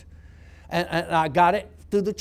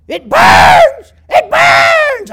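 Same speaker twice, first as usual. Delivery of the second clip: shrieking demon, much higher and much louder; demon screaming; 19 dB louder, much slower than usual. Retold or imitated. Demon in pain at the anointing oil, a shrieking scream. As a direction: howl it like the oil scorches you. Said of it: "that demon screamed".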